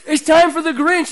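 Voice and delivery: high, loud thrilled announcement; excited. Acted. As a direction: burst out like a kid before the big show. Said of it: "as you got all excited".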